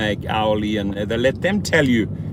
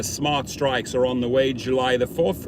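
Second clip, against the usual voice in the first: ordinary talk, nothing marked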